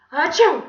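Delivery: loud, high achoo; acted sneeze. A narrator's reading. Villain with pepper pie in his face, sneezing. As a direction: burst out with a big sneeze. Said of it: "he began to sneeze".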